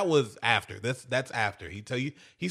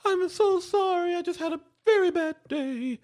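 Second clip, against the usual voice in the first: much higher, put-on apologetic woman's voice; mock upset wife. Wife apologizing to her husband after her bad day, high mock voice.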